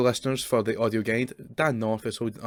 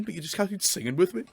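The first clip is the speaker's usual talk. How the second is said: accidental Scouse; 7 semitones higher, 3 dB louder, slower than usual. Real, not acted. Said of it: "I don't know why you sounded Scouse there".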